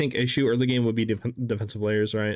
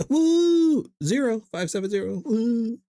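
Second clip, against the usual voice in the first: excited whooping; 11 semitones higher, slower than usual. Real, not acted. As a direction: cheer like you're winning. Cheering queue progress.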